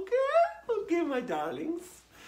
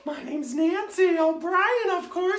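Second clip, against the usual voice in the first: Nancy persona; 6 semitones higher, 5 dB louder than usual. Acted. Character introduces herself.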